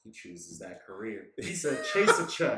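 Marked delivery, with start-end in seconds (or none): 1.9-2.6 high mock cartoon voice; bouncy mock SpongeBob; hammy SpongeBob quote. Quoting SpongeBob's line.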